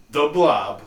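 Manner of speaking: loud, slow announcer reveal; dramatic reveal. Acted. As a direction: boom out the title like a trailer. Dramatic movie title reveal.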